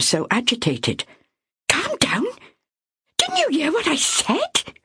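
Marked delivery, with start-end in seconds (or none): 1.7-4.7 very high, indignant questions; exasperated disbelief. Acted. Myra snapping back at Ethel, exasperated.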